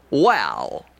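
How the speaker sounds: a breathy whispered "Well" in Benny style; Jack Benny well. Shown as a demonstration. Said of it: "door to Jack Benny".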